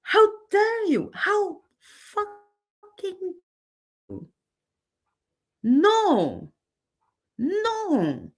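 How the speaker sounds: high, outraged "how dare you"; furious outburst. Real, not acted. Vlogger reliving her rage at another creator, furious. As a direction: let the fury break through. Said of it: "I get angry already again".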